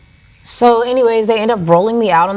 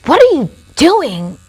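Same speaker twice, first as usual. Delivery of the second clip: rude paramedic; 8 semitones higher, 5 dB louder, slower than usual. Retold or imitated. Paramedic confronting her.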